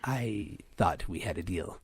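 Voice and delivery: slow, low and soft, faintly sultry; lightly sultry. Acted. Android disputing the price with her repairer, lightly sultry. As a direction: let it sink low and slow, a little sultry. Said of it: "I'll do a sultry voice".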